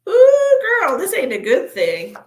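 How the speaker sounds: dismayed ooh. Real, not acted. Reacting to bad card.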